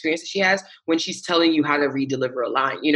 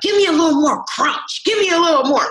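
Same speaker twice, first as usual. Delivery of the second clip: high, loud coaxing director voice; imitating the director; 13 semitones higher, 5 dB louder than usual. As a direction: egg them on in a high excited voice. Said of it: "she's like".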